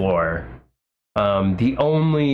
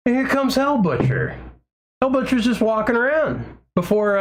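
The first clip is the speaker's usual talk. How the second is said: excited; 10 semitones higher than usual. Real, not acted. Excited arrival of idol.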